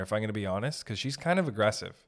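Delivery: usual speaking voice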